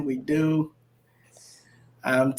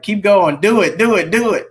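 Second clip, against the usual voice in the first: louder, rising cheer of encouragement; cheerleading; 5 semitones higher, 6 dB louder than usual. Retold or imitated. Cheering on his spiritual daughter, loud and rising. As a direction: pump her up like a cheerleader. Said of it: "I'm always cheerleading you on".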